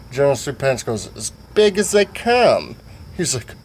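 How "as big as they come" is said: put-on half-drunk character. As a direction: stretch it out in a raised voice. Voices General Sweet Pants.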